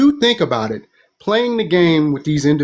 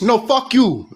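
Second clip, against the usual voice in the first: higher, blunt brush-off; curt refusal; 6 semitones higher, slower than usual. Retold or imitated. Nigerians rejecting the newcomer, curt mock refusal. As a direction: shut him down flat out.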